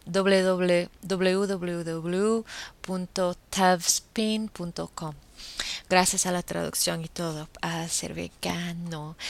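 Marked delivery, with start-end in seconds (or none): 0.0-5.1 slow spelled address. Real, not acted.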